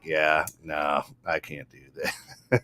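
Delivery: low, soft, slowing brush-off; understated refusal. Retold or imitated. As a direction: drop it to a quiet aside. His own refusal, retold as aside.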